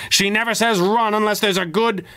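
high, running call; high call, cut off; called back. Acted. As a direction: call out while fleeing. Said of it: "called back".

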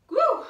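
high loud cheering whoop; excited whoop. Real, not acted. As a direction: let out a big whoop. Cheering mid workout.

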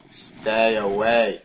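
loud, drawn-out command; slow warning. Real, not acted. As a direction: draw out each word firmly to ward it off. Warding off mobs.

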